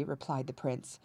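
ordinary delivery, nothing marked

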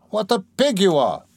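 high, exasperated outburst; stung, hurling it back at the teaser; indignant insult. Acted. Razumihin, teased about blushing, hitting back indignantly.

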